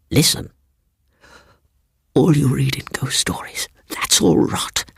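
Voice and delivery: breathy whisper. A narrator's reading. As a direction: let the words fall to a whisper. Seaton whispering.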